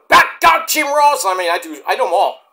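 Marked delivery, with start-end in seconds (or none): 0.1-1.2 wrestler promo. Retold or imitated. Wrestler impression shouting.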